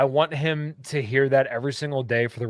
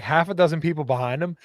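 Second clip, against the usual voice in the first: ordinary delivery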